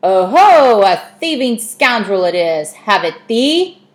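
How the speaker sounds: loud, high mock-pirate snarl; gloating old-time pirate cat; pirate cat hissing. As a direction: pounce on the intruder like a swaggering pirate. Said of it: "a voice from above her hissed".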